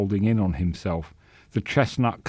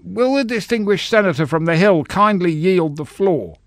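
higher, flustered sputter; 9 semitones higher, 5 dB louder than usual. Acted. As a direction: sputter it out, out of breath. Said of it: "Bracegirdle sputtered".

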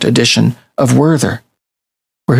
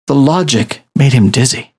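usual speaking voice

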